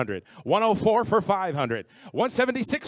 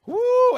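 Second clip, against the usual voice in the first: excited high cheer; high whoop; 6 dB louder than usual. Real, not acted. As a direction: whoop at the bid. Cheer at big bid.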